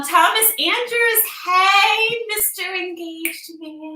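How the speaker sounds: teasing. Real, not acted. Teasing greeting to viewer.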